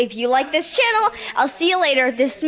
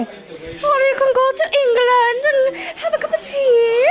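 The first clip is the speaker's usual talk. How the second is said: slow, high hammed-up posh English accent; a playful put-on English accent; mock English accent; 11 semitones higher, 3 dB louder than usual. Host teasing about accents in a mock posh English accent.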